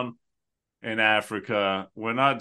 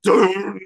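loud, high imitated drum boom; one booming "dun"; title card hit; 8 semitones higher, 6 dB louder than usual. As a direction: punch it like a final drum hit. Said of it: "it just title card again".

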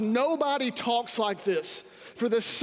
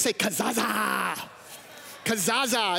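say it like you mean it. Shown as a demonstration. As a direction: belt out kazaza slowly with conviction. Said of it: "Say it like you mean it".

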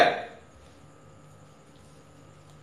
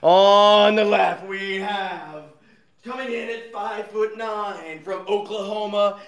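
grand entrance announcement; 3 semitones higher, 4 dB louder, slower than usual. Acted. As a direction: ring announcer introducing a fighter. Introducing contestant like fight.

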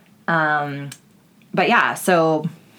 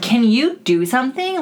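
high, slow plea; drawn-out exasperated plea; pleading bouncer call; 8 semitones higher, 4 dB louder than usual. Retold or imitated. Dancer begging the lazy bouncer for help, high and slow.

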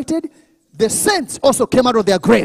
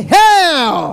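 loud, high call for echo; rallying shout; 5 semitones higher, 7 dB louder than usual. Shown as a demonstration. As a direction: shout it so the congregation shouts back. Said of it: "Someone shout".

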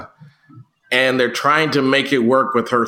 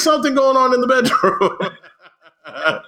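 laughing; 9 semitones higher than usual. Retold or imitated. Repeating kids' line laughing.

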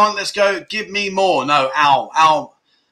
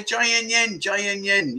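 softer soothing repeat; calming; 4 semitones higher, 5 dB quieter than usual. Retold or imitated. Calming the missus who wants to go out, soft. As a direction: ease the missus down gently. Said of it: "just say".

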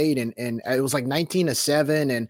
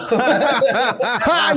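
high, loud belly laugh; big burst of laughter; 10 semitones higher, 5 dB louder than usual. Real, not acted. Co-host hears the coin toss news, bursts out laughing.

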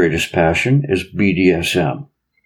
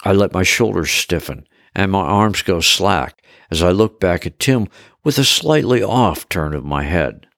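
plain reading voice

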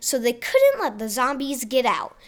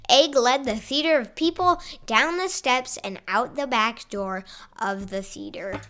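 plain reading voice

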